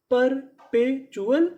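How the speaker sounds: Perpetual stretched slowly, rising at the end; very slow syllable by syllable. Shown as a demonstration. Teacher to students, sounding out the word slowly. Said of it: "तो पूरी meaning क्या बनी?".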